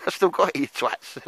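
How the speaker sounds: high, loud, laughing boast; gleeful boast. Real, not acted. Bragging to the lads after a throw, high and loud. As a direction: crow about it to your mates.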